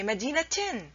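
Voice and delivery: مدينتين said "madinatin", t before the -in ending; ta sound kept. Shown as a demonstration. Teacher proves the ta remains, مدينتين pronounced "madinatin". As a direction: finish مدينتين on "tin". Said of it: "you still pronounce it تا".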